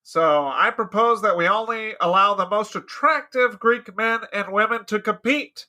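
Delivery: loud, declaiming senator; orating Socrates. Acted. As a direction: orate to the council. Acted committee debate, Socrates speaking.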